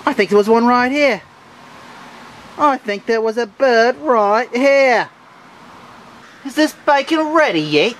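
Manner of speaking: high, loud voice for the dog. Pretend. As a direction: speak for the pet dog, high and eager. Owner voicing dog by the grill.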